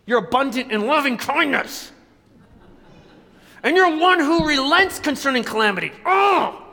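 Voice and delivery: high, loud exasperated rant; angry Jonah. Acted. Jonah resenting God's loving kindness, ranting angrily. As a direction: rage upward into a frustrated cry. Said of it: "makes me so mad".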